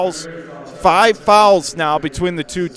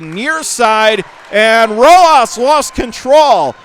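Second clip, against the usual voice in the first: excited call; 5 semitones higher, 6 dB louder, slower than usual. Real, not acted. Sudden loss of control call.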